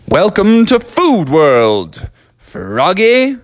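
high, slow welcoming cartoon voice; Candy Bar Carl. Carl greeting Froggy, high slow cartoon welcome. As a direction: welcome him like a tiny host. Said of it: "said Carl".

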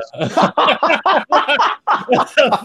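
high, rolling laughter; long laugh. Real, not acted. Remembering a funny story about that song, laughing. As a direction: crack up for a good stretch.

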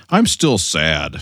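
drawn-out lightly hammed sadness. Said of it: "[name] was like".

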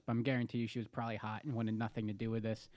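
ordinary talk

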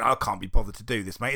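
ordinary talk

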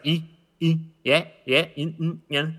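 "E, e, yeah, yeah" as exaggerated little word endings; mock your own endings on "yeah"; short isolated "E", "mm" and "yeah" as affected tails; pretentious word endings. Shown as a demonstration. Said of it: "you end your words like".

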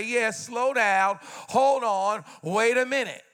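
slow and drawn out; slow the young man down; slow cautioning elder. Retold or imitated. Older mentor telling a young athlete to slow down. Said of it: "You need somebody to say".

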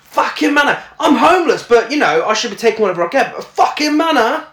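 a hammed-up furious mum; mock fuming. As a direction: rant as the outraged mum. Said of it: "Adeline is like".

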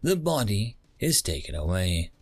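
usual speaking voice

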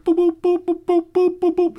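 high, loud string of robotic boops; imitated computer beeps. Podcaster imitating the AI at work, high beeping. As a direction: rattle off machine boops.

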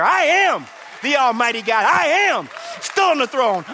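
loud, high preaching as God; full-force preaching. Acted. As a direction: build it up and declare it to the church. Preaching God's words at full force.

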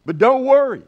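mock Southern drawl for reassurance. Preacher recalling Jim reassuring brethren, light Southern drawl. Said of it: "he used it in a Southern drawl accent".

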